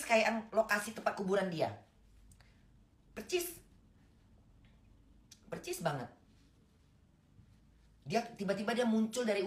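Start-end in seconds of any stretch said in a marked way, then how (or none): 3.1-6.1 high insistent exclaim. Real, not acted. Insisting dream matched the grave.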